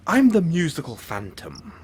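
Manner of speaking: high, loud villainous self-introduction; grand announcement. Acted. Villain finally introducing himself to listener, grand. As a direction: proclaim your villain name with a flourish.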